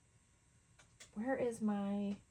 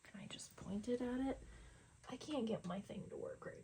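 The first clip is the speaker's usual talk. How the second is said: whispering; 9 dB quieter than usual. Real, not acted. Muttering at the camera.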